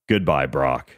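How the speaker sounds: usual speaking voice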